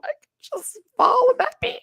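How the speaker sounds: high, cracking mock cry; hammed-up near-sob about falling; mock wail. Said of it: "Oh no".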